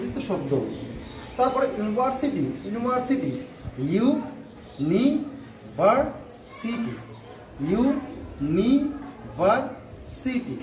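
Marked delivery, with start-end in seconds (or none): 3.9-6.9 university very slowly in separate beats; slow teacher's syllable breakdown. Shown as a demonstration. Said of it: "চারটি syllable আছে". 7.7-10.5 university very slowly in separate beats; slow teacher's syllable breakdown. Shown as a demonstration. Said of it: "চারটি syllable আছে".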